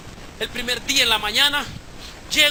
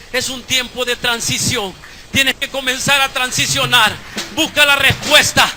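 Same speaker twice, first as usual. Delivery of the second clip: louder and louder, urging the congregation; fiery preaching; 2 semitones higher, 11 dB louder than usual. Acted. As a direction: preach it at full force, building. Preaching builds to climax.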